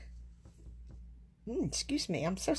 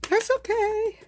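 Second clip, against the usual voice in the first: high, louder sing-song "That's okay"; singsong reassurance; 11 semitones higher, 7 dB louder than usual. Real, not acted. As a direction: brush off the mistake in a bright high lilt.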